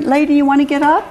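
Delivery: high, quick, sweet request; polite coaxing. Acted. Maureen now asking Lady instead of ordering, politely. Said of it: "the conversation between them began to change".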